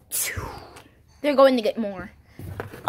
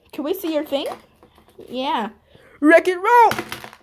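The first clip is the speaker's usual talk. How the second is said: ordinary talk, nothing marked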